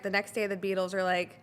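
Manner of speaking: usual speaking voice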